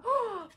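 quick high gasp of joy; delighted gasp. Real, not acted. Vlogger finding unicorn stickers, a delighted gasp.

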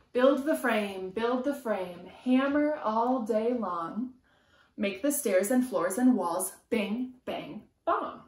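lively sing-song picture book reading; reading to children. A narrator's reading. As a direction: chant the rhyme to a small child. Reading rhyming picture book aloud.

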